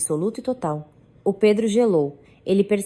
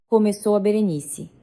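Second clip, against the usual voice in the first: ordinary delivery, nothing marked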